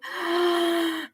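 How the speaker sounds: long, high gasp; shocked gasp. Real, not acted. Reader takes in a viewer's tragic story, shocked gasp. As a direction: draw breath in sharply, stunned.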